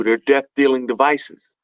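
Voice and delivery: ordinary delivery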